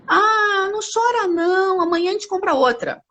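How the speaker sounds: mild coaxing mother voice; mother soothing. Retold or imitated. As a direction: play a mother hushing her crying child. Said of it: "Ela pode dizer assim".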